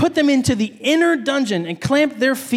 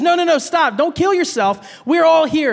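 urgent shout; 6 semitones higher than usual. Retold or imitated. Preacher retells Paul stopping the jailer, urgent and raised. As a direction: call out to stop the jailer. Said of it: "Paul says".

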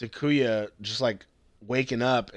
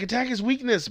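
higher-pitched retold command; lightly acted rallying line; mock battle call; 11 semitones higher than usual. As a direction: voice characters urging an attack.

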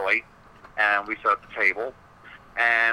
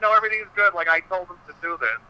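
bright reassurance; 8 semitones higher than usual. Retold or imitated. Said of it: "She's like".

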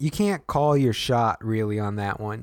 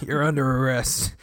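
ordinary delivery

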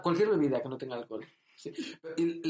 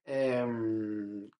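ordinary delivery

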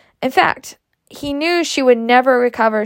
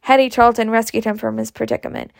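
ordinary delivery

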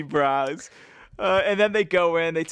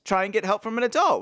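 ordinary talk, nothing marked

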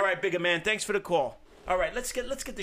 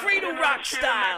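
catchphrase shout; 8 semitones higher, 5 dB louder, slower than usual. Acted.

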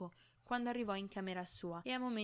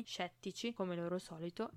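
ordinary delivery, nothing marked

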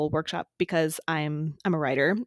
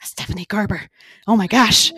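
excited gasp; 6 dB louder than usual. Retold or imitated. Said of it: "I was like".

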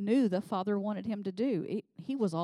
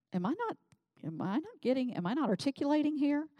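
higher-pitched mock exasperation; imagined exasperated Jesus; 7 semitones higher than usual. Preacher imagining Jesus losing patience with his disciples, playfully exasperated. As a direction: act out a teacher baffled at not getting through. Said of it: "Jesus had a moment where he was like".

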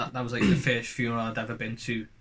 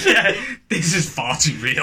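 laughing through the words; loud laugh, drawn out; 10 semitones higher, 9 dB louder, slower than usual. Real, not acted. Laughs at dark wrestling story.

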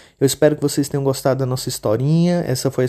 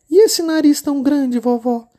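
put-on much higher, childlike little girl voice; 13 semitones higher, 5 dB louder than usual. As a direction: play the innocent little girl. Menina pergunta. (menininha de voz fininha e aguda)

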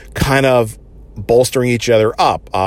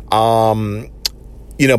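normal talking voice

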